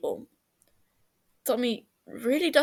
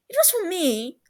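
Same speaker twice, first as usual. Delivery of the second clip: cheeky; 10 semitones higher than usual. Acted. Cheeky retort.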